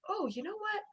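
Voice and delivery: grateful morning joy. Retold or imitated. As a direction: greet the morning with sudden delight. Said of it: "just going".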